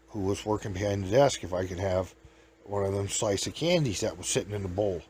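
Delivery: usual speaking voice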